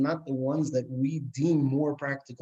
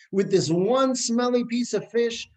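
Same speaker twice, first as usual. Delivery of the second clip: emphatic preaching; 10 semitones higher, 4 dB louder, slower than usual. Acted. Preacher drives home the point.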